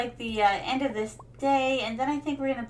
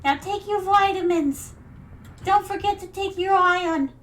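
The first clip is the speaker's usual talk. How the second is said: high, loud Jersey mom nagging; a Jersey mother scolding in a thick Jersey accent; 6 semitones higher, 3 dB louder than usual. Retold or imitated. Jersey mother nagging daughter about vitamins in Jersey accent. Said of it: "Now, a Jersey accent".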